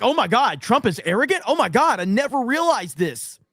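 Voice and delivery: high, gushing fake amazement; sarcastic mock shock. Mocking a Twitter user with fake shock. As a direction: pretend it's the biggest revelation ever. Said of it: "You're like".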